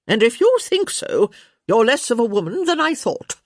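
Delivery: loud, high countess scolding; crotchety countess. A narrator's reading. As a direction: scold emphatically as a blunt old countess.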